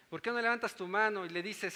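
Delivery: usual speaking voice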